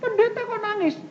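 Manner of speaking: high-pitched imitated jeer; mock teasing. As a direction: imitate someone teasing the preacher. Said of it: "Ada yang ledek gitu".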